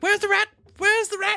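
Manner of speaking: high, urgent questioning; acted high-pitched urgency; frantic guard. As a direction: play a flustered guard searching in panic. Flustered guard searching amid screaming women.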